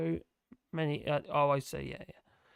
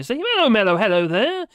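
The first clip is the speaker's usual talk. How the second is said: higher, louder sing-song hello; playful greeting; 3 semitones higher, 9 dB louder than usual. Real, not acted. Greeting a chat friend, playful loud hello.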